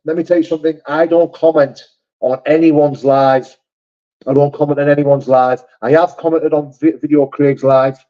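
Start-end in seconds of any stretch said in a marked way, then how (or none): 0.9-3.6 slow, each word set down firmly; slow emphatic denial. Real, not acted. To chat denying he commented, slowly and firmly. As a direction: spell it out so chat believes him.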